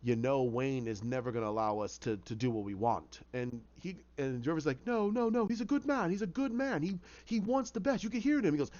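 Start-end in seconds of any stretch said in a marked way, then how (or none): 0.0-3.2 low, insinuating inner voice; voice in his head. Acted. Dark inner voice turning Jervis against Wayne, low. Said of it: "hear the voice in his head". 4.8-8.0 high, insistent pleading denial; anxious protest. Acted. Jervis defending Wayne to the inner voice, anxious.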